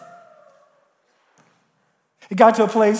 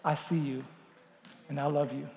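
very soft, tender and low; tender voice of God; 9 semitones lower, 16 dB quieter than usual. Acted. Preacher voicing God's gentle approval, very soft. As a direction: speak it like a loving father. Spirit reassures the believer.

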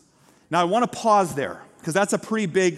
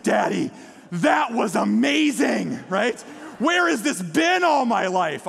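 put-on excited child shout; 3 semitones higher, slower than usual. Daughter shouting to dad after sledding, thrilled. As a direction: shout it like a kid who loved the ride.

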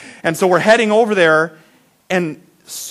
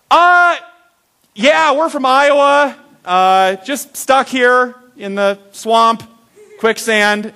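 put-on sheepish kid; 6 semitones higher, 4 dB louder, much slower than usual. Sheepish to the rest stop man.

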